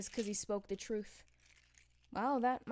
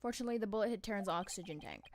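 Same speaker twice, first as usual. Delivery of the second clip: normal talking voice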